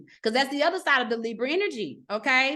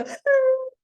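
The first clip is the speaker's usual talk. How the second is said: put-on loud, high-pitched baby cry; imitated baby wail; 12 semitones higher, 5 dB louder than usual. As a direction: burst out like a startled baby. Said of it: "and the baby go".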